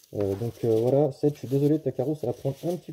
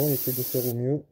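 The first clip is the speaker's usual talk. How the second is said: ordinary talk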